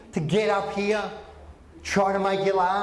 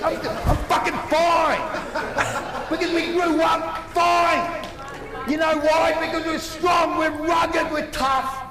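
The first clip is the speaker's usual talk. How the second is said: mock tough shout; 8 semitones higher than usual. Comedian insisting to the crowd he's fine, mock shouting. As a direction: bellow that you grew up fine. Defiant rant to the audience.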